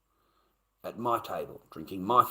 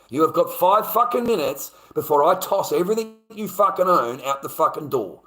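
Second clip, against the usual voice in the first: loud, raised-voice eviction rant; angry; 6 semitones higher, 7 dB louder than usual. Retold or imitated. Man ordering the pair to pack and leave, angry shouting. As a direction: lay down the ultimatum at full force. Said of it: "I was so fucking angry".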